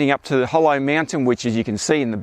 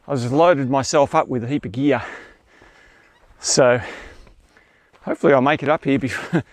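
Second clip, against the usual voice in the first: usual speaking voice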